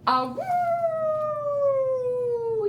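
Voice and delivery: high howling wolf cry; dog howl. Acted. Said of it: "he howled".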